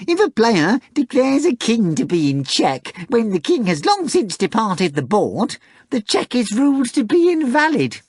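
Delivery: a smug cat boasting it will win; high showy talking cat, sure of victory; pompous cat. Acted. Cat Behemoth's voice.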